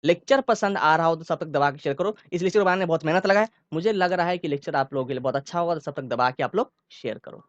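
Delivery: calm start, fast burst in the middle, then back to calm; speeding up then easing back. Shown as a demonstration. Teacher demos speaking speed changing mid-sentence for students. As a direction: rush the middle, relax at the ends. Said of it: "अब देखो इसको मैं कैसे बोलूंगा".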